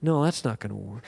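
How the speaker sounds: low, soft muttering under his breath; half to himself, a retold mumble; imitated mumbling. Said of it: "I can hear him mumbling".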